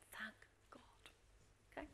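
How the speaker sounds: gasp, then whisper it in relief; relieved gasped whisper. Retold or imitated. Office staff relieved the coach arrived, gasping whisper. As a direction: staff sigh out a hushed thank God. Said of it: "the room goes like this".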